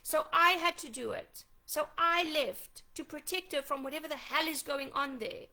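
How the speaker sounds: loud and indignant; angry. Real, not acted. Mother's furious public accusation.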